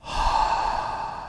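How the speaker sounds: long, airy exhale; long breath out. A narrator's reading. Turtle breathes on Daniel and Noah, long breath out. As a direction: breathe gently out over someone. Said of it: "the turtle smiled and breathed on Daniel and Noah".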